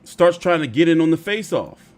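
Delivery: high, loud, disbelieving; can't believe what he's seeing; incredulous outrage. Real, not acted. Outraged retelling of face-off.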